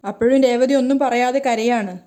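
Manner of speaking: usual speaking voice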